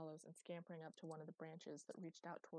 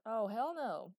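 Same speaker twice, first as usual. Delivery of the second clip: loud, higher, exuberant refusal; emphatic denial; 4 semitones higher, 12 dB louder, slower than usual. A narrator's reading. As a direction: wave the question off loudly. Boy emphatically dismisses the question.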